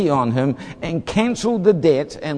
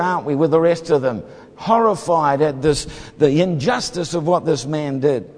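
usual speaking voice

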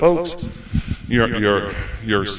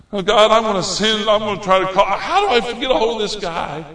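higher-pitched, urgent appeal; worked-up prayer; 8 semitones higher than usual. Retold or imitated. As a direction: retell an eager cry to God. Retells his eager prayer to God.

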